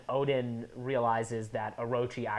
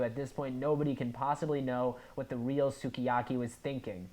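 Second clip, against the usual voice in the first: ordinary talk, nothing marked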